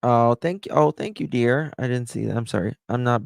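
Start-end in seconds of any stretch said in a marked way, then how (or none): 0.0-1.7 higher, louder, sweet thank-you to a viewer; gushing thanks. Real, not acted. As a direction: coo a thank-you at a donor. Thanking a gifter on stream.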